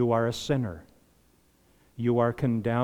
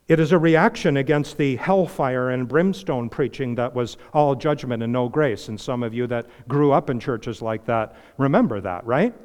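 ordinary talk, nothing marked

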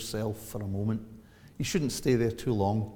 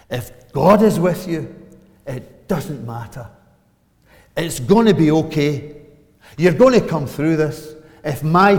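much louder, higher, slowed revival preaching; preaching at full force; 7 semitones higher, 16 dB louder than usual. Real, not acted. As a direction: drive it home to the whole room. Preaching climax to the congregation.

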